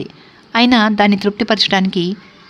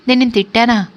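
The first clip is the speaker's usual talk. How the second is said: plain reading voice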